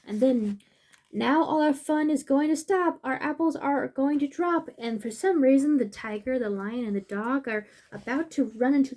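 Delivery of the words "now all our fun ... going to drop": storybook animal voice. Acted. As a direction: insist they cannot let them.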